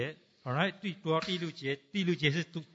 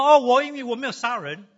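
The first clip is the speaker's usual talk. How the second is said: imitating a self-satisfied excuse, higher and louder; preacher mimicking a non-believer defending himself; mock non-believer; 4 semitones higher, 8 dB louder than usual. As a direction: play a proud man listing his virtues. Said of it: "你说".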